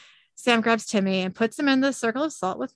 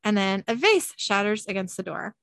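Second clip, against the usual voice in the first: ordinary delivery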